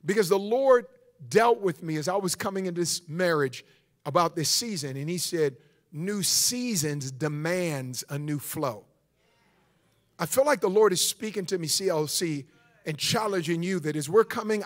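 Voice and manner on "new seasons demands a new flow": low, soft and slow; solemn quoted pronouncement. Retold or imitated. Preacher passes on what the Lord said, slow solemn pronouncement. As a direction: deliver it slowly, like a weighty revelation. Quotes the Lord's word to him.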